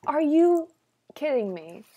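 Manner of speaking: loud, high ARE YOU, then dropping; high, loud outburst that settles on "kidding me"; mock incredulous. Real, not acted. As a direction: exclaim it in playful disbelief. Incredulous answer to cartoon question.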